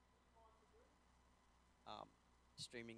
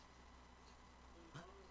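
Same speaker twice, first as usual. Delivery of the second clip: mock offended gasp; 7 semitones higher, 8 dB quieter than usual. Mock outrage over nachos.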